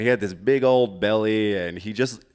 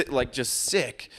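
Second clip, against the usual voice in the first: normal talking voice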